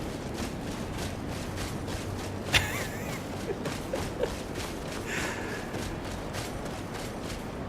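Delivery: long breathy cackle; laughing. Real, not acted. Player laughs at own remark, long breathy laugh. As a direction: laugh it off at length.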